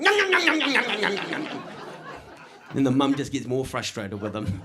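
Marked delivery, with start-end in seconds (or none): 0.0-1.6 high gloating yum-yums fading down; gloating yums that drop off; mock yum. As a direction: tease the kid while chewing, starting high. Eating treat in front of kid.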